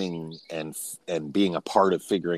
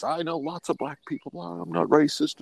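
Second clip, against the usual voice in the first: mock defensive whine; 11 semitones higher than usual. Mocking friend's stock defenses.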